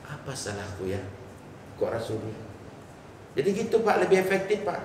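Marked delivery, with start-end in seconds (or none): 0.1-2.5 much softer and lower, as if asking herself; a wife quietly doubting herself after her husband goes silent; wife's worried inner voice. Pretend. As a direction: murmur the worry to yourself, hushed. Wife wonders why husband silent.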